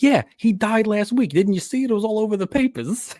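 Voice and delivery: high-pitched mock nagging; exasperated girlfriend. Girlfriend telling him the lawyer died, high mock scolding. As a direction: rub it in with imitated high exasperation.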